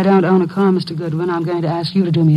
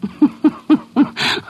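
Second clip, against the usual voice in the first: a short high nervous laugh; 9 semitones higher than usual. Acted. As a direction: laugh it off, caught out. Laughs off accusation of doping drink.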